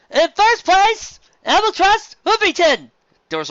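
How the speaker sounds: high, loud, slow proclamation; awards announcer. Acted. Announcer calling the first-place winner, loud and slow. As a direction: boom it to the crowd.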